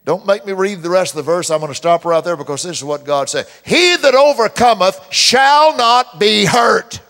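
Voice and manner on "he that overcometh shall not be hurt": loud, slow, high proclamation; full-force preacher declaring scripture; preaching at full force. Acted. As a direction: proclaim the verse to the crowd. Preaching the key verse.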